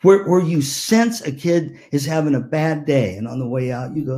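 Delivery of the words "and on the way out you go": ordinary talk, nothing marked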